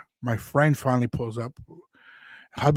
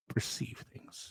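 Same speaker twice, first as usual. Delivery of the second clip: soft hushed; 3 semitones lower, 16 dB quieter, slower than usual. Retold or imitated. Retold calm reply.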